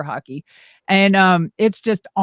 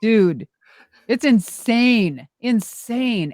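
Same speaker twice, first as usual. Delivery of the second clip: ordinary delivery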